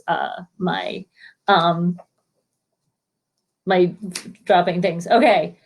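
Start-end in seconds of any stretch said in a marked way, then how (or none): none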